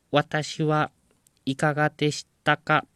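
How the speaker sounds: high, loud machine voice; robot voice. Retold or imitated. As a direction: announce it like a talking machine. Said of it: "機械音声みたいな".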